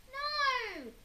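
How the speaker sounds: high piercing scream; little brother screaming no. A narrator's reading. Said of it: "screamed Perfect Peter".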